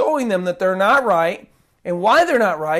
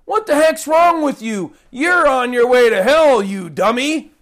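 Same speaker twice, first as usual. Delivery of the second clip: higher, louder scolding rant; mock angry rant; 5 semitones higher, 5 dB louder than usual. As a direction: ham up a furious scold. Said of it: "Jesus never once here says".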